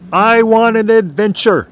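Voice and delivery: high, loud imitation of a wife's yell; retold yell. Husband retells wife's wish from the bedroom, imitated yell. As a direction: yell it out, imitating her calling from another room. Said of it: "I heard my wife yell from the bedroom".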